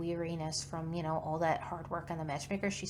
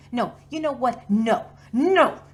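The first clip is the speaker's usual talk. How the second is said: louder and higher with each no; angry refusal; 6 semitones higher, 13 dB louder than usual. Acted. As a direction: build to a furious shouted no. Angry outburst after the call.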